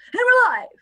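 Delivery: high, loud "we're live" cheer; excited announcement. Real, not acted. Host telling her viewers the stream is live, cheering high. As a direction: announce going live with a burst of joy.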